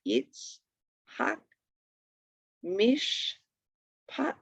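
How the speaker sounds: itz, hak, mish, pat, each set apart; long pauses between "itz", "hak", "mish", "pat"; syllable by syllable. Shown as a demonstration. Teacher reading syllable examples to students, each one separate. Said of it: "indicates the end of a syllable".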